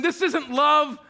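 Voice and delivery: loud mock accusation; mock protest. Believer protesting to God, loud. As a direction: snap it at God. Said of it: "when I call back to God saying".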